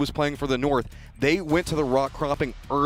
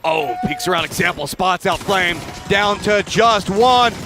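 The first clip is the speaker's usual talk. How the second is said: building casting call; 9 semitones higher, 9 dB louder, much slower than usual. Real, not acted. Live call of the final fight.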